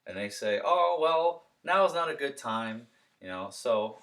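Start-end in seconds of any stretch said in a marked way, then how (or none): 0.6-2.9 homeowner brush-off. Shown as a demonstration. Homeowner objection.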